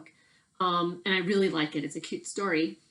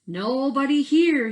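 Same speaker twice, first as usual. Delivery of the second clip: higher, louder, slow call; slow bored call into the bushes; muskrat calling out; 7 semitones higher, 8 dB louder, slower than usual. Acted. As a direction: Cork searching and calling. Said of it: "he said".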